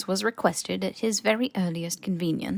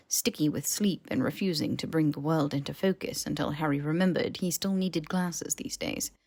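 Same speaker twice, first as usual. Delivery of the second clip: usual speaking voice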